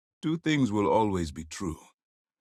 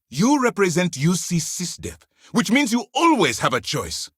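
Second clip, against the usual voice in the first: loud, high-pitched commander's reprimand; raised voice, laying down the law; stern rebuke; 8 semitones higher, 6 dB louder than usual. Acted. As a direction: drive it home forcefully to a subordinate.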